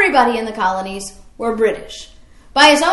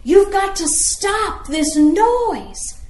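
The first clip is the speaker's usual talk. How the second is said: higher, slow, put-on scolding voice of a guard; sentinel scolding; 6 semitones higher, much slower than usual. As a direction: scold the noisy rider, drawn out and pitched up. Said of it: "yelling at, at Revere".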